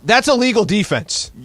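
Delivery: high, loud shout from the stands; yelled call. Retold or imitated. Basketball parent yelling at the ref, loud. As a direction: yell it at the referee. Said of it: "I'm gonna yell".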